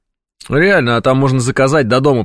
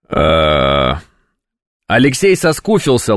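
normal talking voice

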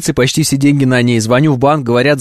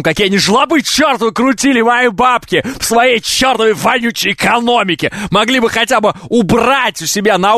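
higher, ranting with fake outrage; mock rant; 7 semitones higher than usual. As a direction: rant about the stingy bank. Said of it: "Тем больше я могу орать". (наигранно возмущённо)

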